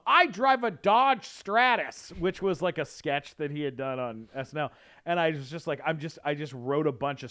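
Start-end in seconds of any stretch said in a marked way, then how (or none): 0.1-2.1 slow, loud, high indignant boast; Will Ferrell. Retold or imitated. Actor borrowing a sketch line, mock-furious and pompous. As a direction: announce it with absurd self-importance. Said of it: "it was the Will Ferrell".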